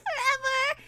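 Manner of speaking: high, sweetly put-on voice; mock-pretty high register. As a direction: lift it up high to sound pretty. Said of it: "my voice can stay all pretty".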